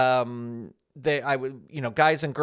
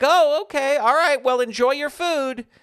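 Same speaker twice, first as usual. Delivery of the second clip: high, louder imitation of chirpy kids; imitated polite teens; 9 semitones higher, 5 dB louder than usual. High school kids sending a stranger off, imitated politely. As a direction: pitch it up as teens politely signing off.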